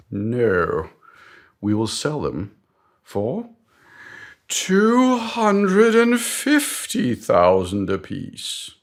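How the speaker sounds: grand mock-British reveal, high and drawn out; pause, then announce the figure loudly in a British accent; British boss. British boss revealing the new price, grand slow mock-British. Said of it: "I d-, don't know why he's British".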